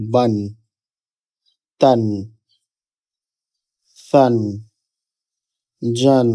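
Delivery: "বান, তান, সান, জান" as separate, carefully sounded syllables; each of "বান, তান, সান, জান" sounded alone for learners; demonstrated letter pronunciation. Shown as a demonstration. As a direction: model "বান, তান, সান, জান" one by one, with clear pauses. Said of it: "এইভাবে আমাদের হরফগুলো উচ্চারিত হবে".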